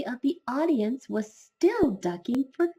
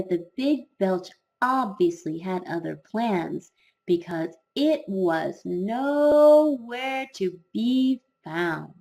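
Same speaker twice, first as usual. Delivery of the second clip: ordinary delivery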